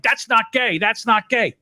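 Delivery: high-pitched mocking mimicry; mock indignant protest; mocking imitation. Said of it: "She's like".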